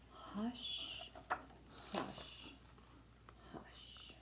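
breathy, fading shush; whispered hush. Acted. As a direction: hush a baby to sleep. Bedtime shushing from book.

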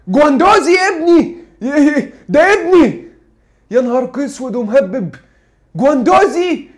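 loud, high cries of disbelief; shocked outcry. Real, not acted. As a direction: yell it out in horror at the news. Shocked by Bayern's bid. (صرخة صدمة عالية)

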